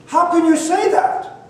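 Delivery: high-pitched retold indignation; indignant protest. Leader answering the young man's insult, high indignant protest.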